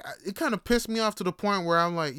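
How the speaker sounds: ordinary talk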